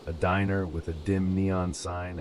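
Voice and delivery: ordinary delivery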